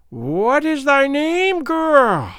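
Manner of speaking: put-on high, slow, eerie old crone voice; piped Gagool. Old witch Gagool questioning the doomed girl, high piping crone voice. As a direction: pipe it out like a wicked old witch. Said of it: "piped Gagool".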